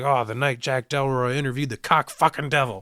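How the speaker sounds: imitating someone thrilled; super excited. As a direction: crow it like a thrilled TV host. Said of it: "Jack is super excited".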